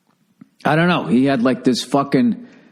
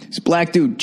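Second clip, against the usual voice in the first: normal talking voice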